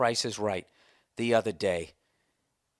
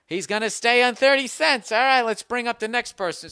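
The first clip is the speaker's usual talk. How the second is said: imitating Drew Carey; 7 semitones higher, 4 dB louder than usual. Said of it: "Drew Carey just went like".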